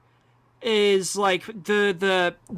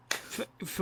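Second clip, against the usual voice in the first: ordinary talk, nothing marked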